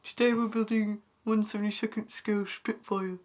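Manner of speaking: a hint of a YouTube modeller intro; imitating Owen. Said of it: "I don't sound like that".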